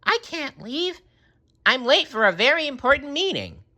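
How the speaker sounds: high, hurried self-important; too busy to stay; SpongeBob voice. A narrator's reading.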